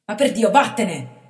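loud, slow stifled angry order; suppressed shout. A narrator's reading. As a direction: bark it under your breath. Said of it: "gridò con voce repressa".

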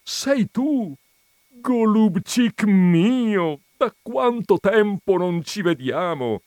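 high, loud, stretched-out delight; exclaimed greeting. Acted. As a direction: greet a long-lost friend with booming astonishment. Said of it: "esclamò il grasso vedendo il magro". (esclamazione acuta di gioiosa sorpresa)

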